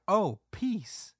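high-pitched, loud exclamation; background onlooker. Retold or imitated. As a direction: imitate an onlooker piping up high. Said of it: "Somebody's like".

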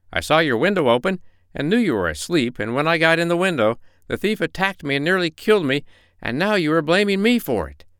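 high-pitched, indignant fox pleading his case; wronged fox, high and insistent; protesting fox. Acted. Fox argues with bear.

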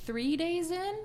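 high, drawn-out incredulous question. Acted. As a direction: climb upward in mock disbelief. Mock disbelief at short timespan.

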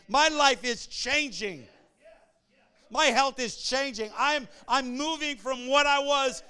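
loud, high-pitched preacher in full flight; full-force preaching. Acted. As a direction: preach it out to the whole congregation, punching key words.

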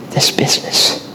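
breathy bitter whisper. Retold or imitated. Rich father confessing his loss, whispered regret. Father mourning lost children.

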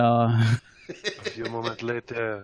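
deep, soft, slow documentary narration; nature documentary. Acted. Documentary narrator cut line.

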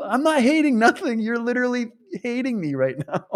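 high-pitched protest tipping into laughter; reenacted giggly objection; retold amused protest. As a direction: protest in disbelief, about to crack up. Retells own laughing protest to heckler.

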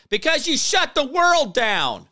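high, drawn-out yell; furious high-pitched shout; emotional outburst. Real, not acted. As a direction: burst out in accusation. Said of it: "I got emotional there".

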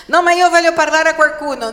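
loud, high, fast mock protest; protesting objector. Believer objects to praying alone, loud mock protest.

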